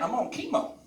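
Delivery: normal talking voice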